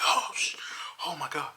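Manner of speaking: breathless frightened whisper; scared whisper. Retold or imitated. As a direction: gasp it out as the monster appears. Startled by monster in dark tunnel.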